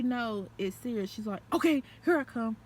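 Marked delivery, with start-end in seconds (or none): 1.5-2.6 panicked. Retold or imitated. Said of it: "she was in a panic".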